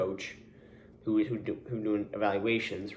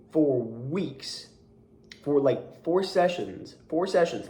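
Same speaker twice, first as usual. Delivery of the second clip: indignant rant; 5 semitones higher, slower than usual. Real, not acted. Coach venting to friend about parent who cursed him out, high indignant rant. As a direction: vent about the lunatic parent.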